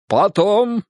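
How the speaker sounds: high, firm cut-in; confident interruption. A narrator's reading. Goga halts his friend's confession, confident. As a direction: stop him with full assurance. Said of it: "уверенно остановил его Гога".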